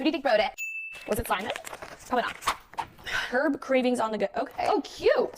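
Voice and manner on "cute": ordinary talk, nothing marked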